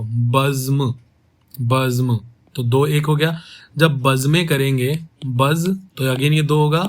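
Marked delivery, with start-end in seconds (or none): 0.0-2.2 "बज्म" said carefully as two beats, baz then m; careful pronunciation. Shown as a demonstration. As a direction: model "बज्म" for the class. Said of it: "देखो उच्चारण देखो".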